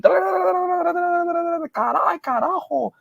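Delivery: high exasperated mom voice in Spanish; a Spanish mom's exasperated curse; scolding Spanish mom. Retold or imitated.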